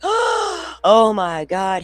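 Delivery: retelling the moment the beagle lunged at the neighbor; shocked. Retold or imitated. Stunned slow cry as the dog lunges at the neighbor.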